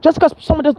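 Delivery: normal talking voice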